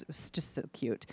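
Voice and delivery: soft, fond whisper; tender whisper. Real, not acted. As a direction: murmur it fondly. Tender aside about the boy.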